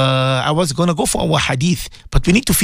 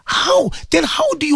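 incredulous; 12 semitones higher than usual. Real, not acted. Host's indignant rhetorical question.

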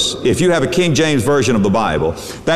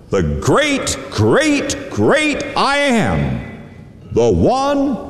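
great, great soaring higher each time; rising preacher's cry; 8 semitones higher, much slower than usual. Acted. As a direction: build to a ringing climax. Preacher building to a climax.

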